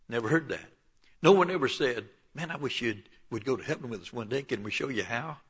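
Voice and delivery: ordinary delivery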